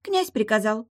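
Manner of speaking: high, brief mermaid answer; unbothered mermaid. Acted. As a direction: state it simply in a high voice.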